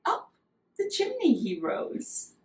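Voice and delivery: ordinary talk, nothing marked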